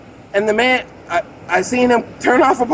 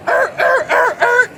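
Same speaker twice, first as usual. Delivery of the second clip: shouted grunts; 13 semitones higher than usual. Retold or imitated. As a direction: bark wordless grunts to get the point across. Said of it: "so I went".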